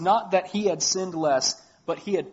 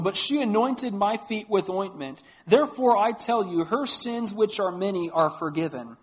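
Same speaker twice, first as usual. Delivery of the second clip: scripture reading; 3 semitones higher, 4 dB louder than usual. Acted. Reads Gospel passage aloud.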